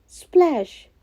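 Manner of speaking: loud splash sound. Acted. Jack jumps in the river, loud splash sound.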